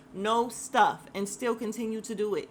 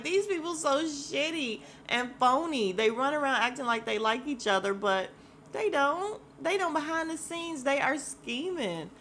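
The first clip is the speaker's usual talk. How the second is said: higher, louder exasperated rant about fake castmates; fed-up rant; 7 semitones higher, 5 dB louder than usual. Vlogger ranting about the phony cast, higher and louder. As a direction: call them out, all worked up.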